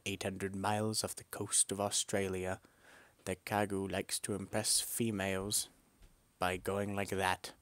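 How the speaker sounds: ordinary delivery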